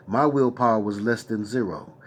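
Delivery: usual speaking voice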